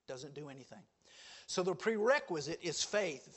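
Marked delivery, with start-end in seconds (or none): 0.1-0.8 quiet echo. Shown as a demonstration. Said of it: "repeated for emphasis".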